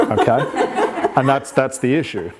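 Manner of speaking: amused, breathy laugh through words. Real, not acted. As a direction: laugh as you say it. Coach laughs at client's answer.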